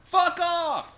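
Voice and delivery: high, loud in-character outburst; angry shout. Acted. Player's character to three intruders on the mansion, angry shout. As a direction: yell it to drive them away.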